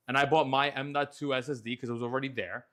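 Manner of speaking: ordinary delivery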